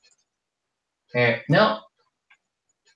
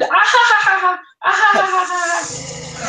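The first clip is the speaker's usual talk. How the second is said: loud, very high hearty German guffaw; Oktoberfest laugh; 4 dB louder than usual. Pretend. German player gloating over a kicker, loud high beer-hall laugh. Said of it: "An Oktoberfest laugh".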